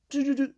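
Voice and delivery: high, quick dialling beeps by mouth; imitated phone dialling. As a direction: sing out little keypad tones.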